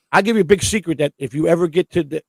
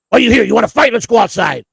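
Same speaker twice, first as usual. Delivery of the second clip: loud, higher-pitched mock challenge to a fight; acted fight challenge; 4 semitones higher, 6 dB louder than usual. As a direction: demand a fight, voice raised, in imitation. Acting out John challenging him to fight.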